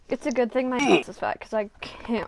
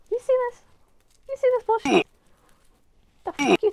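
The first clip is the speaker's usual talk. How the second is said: high-pitched, indignant outburst; exasperated complaint; 11 semitones higher, 4 dB louder, faster than usual. Real, not acted. Vlogger showing viewers the failing craft, high and exasperated.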